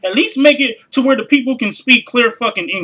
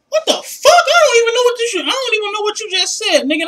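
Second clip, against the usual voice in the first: shocked outburst; 11 semitones higher than usual. Real, not acted. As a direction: shriek it out, totally lost. Baffled by the rep.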